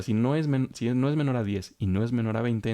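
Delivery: ordinary talk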